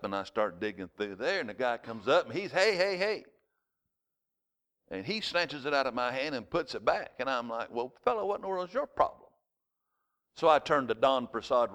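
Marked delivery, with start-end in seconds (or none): 2.5-3.2 higher-pitched imitation of an alarmed shopkeeper; retold urgent protest; alarmed objection. Shopkeeper stopping him at freezer. 7.7-9.3 high, quick mock-indignant comeback; retold exasperated challenge, pitched up. Acting out his indignant reply.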